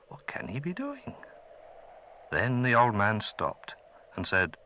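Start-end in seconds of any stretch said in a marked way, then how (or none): 0.1-1.1 soft unvoiced whisper; whispered thought. Chai puzzling over the old man, whispered. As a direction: wonder it under your breath.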